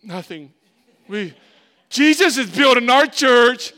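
small "Nothing" bursting into a high, loud declaration; sheepish answer. Retold or imitated. Pastor mocking his own reply, high and loud. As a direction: answer meekly, then proclaim loudly. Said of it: "When I'm hearing all these stories".

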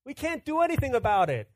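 high, loud mock lament; hammed-up helpless parents. Parents telling the toddler, in a mock lament. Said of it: "we looked at Manny and said".

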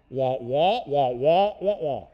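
muted trombone wah-wah noise; lightly done cartoon teacher drone; Charlie Brown's teacher. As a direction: mimic the garbled teacher horn. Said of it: "what you become is Charlie Brown's teacher".

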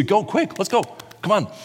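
high, eager hurry-up call; excited rush to go; hurried urging. Retold or imitated. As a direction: push them along, quick.

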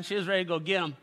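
usual speaking voice

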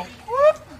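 high, loud mock whoop; startled whoop. All the kids reacting to dad's yell, high startled whoop. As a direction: imitate kids jolted by a shout.

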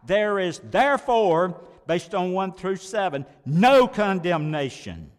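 preacher driving home no condemnation; emphatic climax. Real, not acted. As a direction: land the word no like a verdict. Sermon climax on key phrase.

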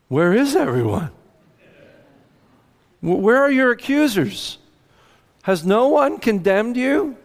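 high, mock-astonished calling out; dramatic Jesus. Preacher voicing Jesus looking up at the empty crowd, dramatically surprised. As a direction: play Jesus, theatrically puzzled. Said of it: "I picture him being a little dramatic".

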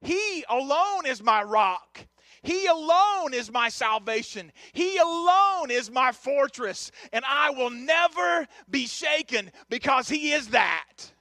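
slow, weighty scripture declaration; measured, punchy pulpit recitation; emphatic preaching. Real, not acted. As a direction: proclaim each phrase with conviction.

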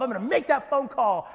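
loud, high mock vow; psyching yourself up. Preacher imitating someone vowing to call a home group, psyched up. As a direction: shout it with hammed-up resolve. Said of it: "you're kinda psyching yourself up".

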